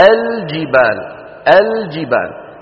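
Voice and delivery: الجبال with a clearly sounded L before the J; clear lam. Shown as a demonstration. Teacher models the correct reading for students, lam clearly sounded in الجبال. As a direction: pronounce the lam in الجبال, "al-jibal". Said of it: "شو بيقولوا؟".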